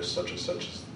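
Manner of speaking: normal talking voice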